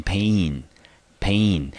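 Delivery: pain said slowly with a long vowel gliding into an E sound; drawn-out pain, like pay-n; slow model pronunciation. Shown as a demonstration. Teacher modelling pain slowly for Taiwanese students, long A sound. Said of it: "所以这个字呢要念成pain".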